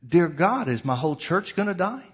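retold desperate cry to God. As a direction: cry the question out loud in anguished prayer. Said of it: "he just asked the question out loud".

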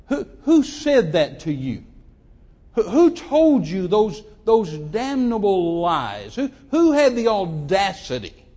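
loud, drawn-out, indignant demand; retold anger. Young man to his crying fiancée about who told her lies, indignant. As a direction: hammer out each slow, incredulous question. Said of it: "It just made me angry".

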